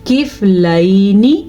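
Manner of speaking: slow, measured tajweed recitation; careful teaching recitation; Quranic recitation. Shown as a demonstration. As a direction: recite it slowly, drawing out the vowels. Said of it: "ہم اس کو پڑھیں گے".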